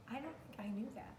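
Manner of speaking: quick, hushed musing; whispered aside. Real, not acted. Teacher reacting to a new chameleon fact, whispered aside.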